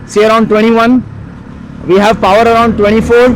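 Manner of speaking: loud, high voice over roaring wind; shouting over wind. Real, not acted. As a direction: raise your voice over helmet wind. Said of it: "sorry about the wind noise".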